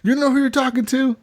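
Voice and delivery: high mock-offended question; mock challenge. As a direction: imitate someone puffing up for a fight. Acted bar quarrel.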